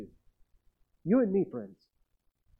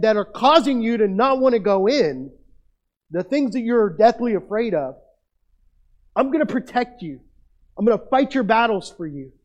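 raised, louder declaration of God's promise; voicing God's promise; 5 semitones higher, 6 dB louder than usual. Preacher voicing God to fearful Israel, loud and declaring. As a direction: proclaim it like God vowing to fight for them. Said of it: "Now, God is saying".

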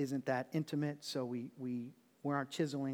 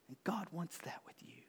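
whisper; 2 semitones higher, 10 dB quieter than usual. Real, not acted. Intimate closing thought.

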